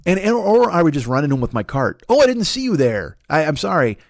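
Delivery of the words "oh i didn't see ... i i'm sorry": higher-pitched feigned surprise; mock apology. Man pretending he rammed someone with his cart by accident, mock apologetic. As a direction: pretend innocent "didn't notice you" apology.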